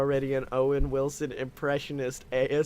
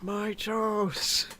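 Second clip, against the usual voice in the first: ordinary delivery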